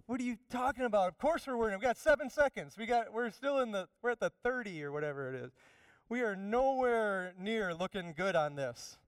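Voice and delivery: high, loud, fast panicked protest; bewildered players shouting back in alarm; panicked teammates. Acted. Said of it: "And they're like".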